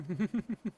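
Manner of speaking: high gloating laugh; evil laugh. Acted. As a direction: cackle up high. Villain laugh.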